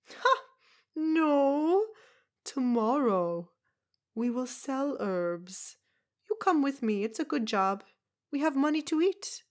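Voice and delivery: put-on a tiny old foreigner scoffing at washerwomen; brusque foreign woman. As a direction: Zelda mocking the town's washing, loud and brusque. Zelda's voice held.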